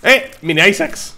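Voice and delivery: high, loud startled shout; surprised. Real, not acted. Streamer surprised by new item, loud high shout. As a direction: burst out at the surprise.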